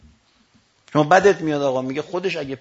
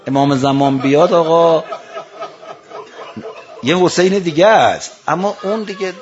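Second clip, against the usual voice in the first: impassioned preaching; 2 semitones higher, 8 dB louder than usual. Acted. Preacher exclaims at climax.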